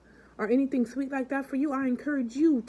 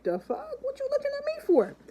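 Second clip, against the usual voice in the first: put-on much higher indignant old lady; mock annoyed lady. Older woman confronting the singing man, high and indignant. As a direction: squeak out the outraged lady. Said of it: "looks at him again, crazy, like".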